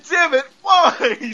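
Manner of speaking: high, drawn-out mock outburst; mad. Quoting Mister Hart demanding answers, imitated mad outburst. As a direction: imitate a furious man, stretching the words out. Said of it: "he was so mad".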